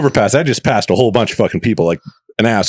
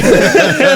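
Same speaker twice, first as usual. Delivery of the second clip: high laugh; 4 dB louder than usual. Real, not acted. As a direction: burst of high giggling laughter. Laughs at own imitation.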